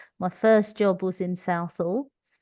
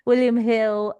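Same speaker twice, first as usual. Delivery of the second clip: Cockney accent; 5 semitones higher, 7 dB louder, slower than usual. Shown as a demonstration. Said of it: "you can hear my Cockney accent there".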